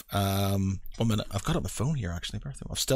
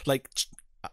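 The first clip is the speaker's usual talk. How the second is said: normal talking voice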